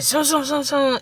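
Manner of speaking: the jet ski finally starting, zoomed happily; engine imitation. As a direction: sing out the jet ski roaring off. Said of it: "It said".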